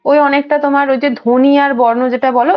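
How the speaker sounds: usual speaking voice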